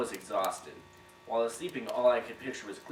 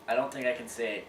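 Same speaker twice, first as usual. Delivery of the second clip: normal talking voice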